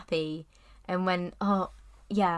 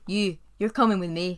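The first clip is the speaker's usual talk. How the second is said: louder, fuller Scottish Shrek voice; better Scottish accent; 5 dB louder than usual. Retold or imitated. Host redoes Shrek's line, better Scottish accent. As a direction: nail the Scottish growl this time. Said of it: "that's very good".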